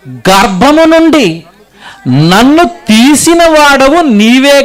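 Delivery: high, slow, projected proclamation; declaimed scripture preaching. Acted. Preacher reads the psalm to congregation, declaimed preaching. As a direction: proclaim the verse to a whole hall.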